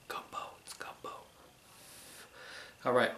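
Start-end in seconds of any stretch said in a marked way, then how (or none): 0.1-1.1 breathy whisper over the bowl. Real, not acted. Host tasting his gumbo, whispering to viewers. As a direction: whisper it like a secret treat. Savouring the first taste on camera.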